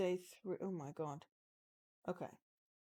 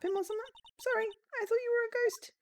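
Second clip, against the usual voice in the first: higher put-on girl voice; high character voice; 6 dB louder than usual. A startled girl apologising to the detective, high voice.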